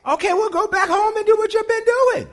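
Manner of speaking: high, loud sarcastic brush-off; high sing-song sarcasm; high mock dismissal. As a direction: shoo them out in a high voice. Pastor mocks a dismissive reply.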